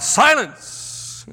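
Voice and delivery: sudden high, commanding boom; the wizard silencing his visitors with a booming cry. Retold or imitated. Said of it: "he booms out".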